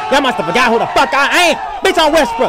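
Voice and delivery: mock furious brag; boastful shout. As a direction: declare it to the arena. Acted boastful outburst.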